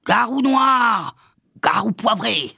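slow, high-pitched shrieked incantation; old witch wailing her spell; yelping witch. A narrator's reading. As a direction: screech out a curse, stretching the words. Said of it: "Elle se mit à glapir".

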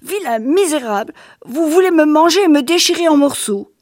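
put-on high, pleading child voice, insults thrown at a monster; a boy terrified and defiant; scared little boy hurling insults; frightened child.

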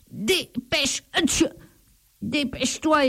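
high, broken by a sneeze; sneezing plea. A narrator's reading. The thief under the straw begs the donkey while sneezing. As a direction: sneeze between the words. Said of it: "éternue le voleur".

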